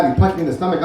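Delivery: ordinary talk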